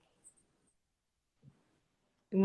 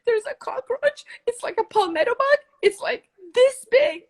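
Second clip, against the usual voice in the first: much higher panicked pleading over a cockroach; 13 semitones higher than usual. Retold or imitated. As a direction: squeal it out as if the bug is right there. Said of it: "I'm like".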